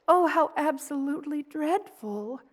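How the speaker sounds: gasping, dismayed sympathy. Acted. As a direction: react with dismay at the nightmare. Reacting to listener's nightmare.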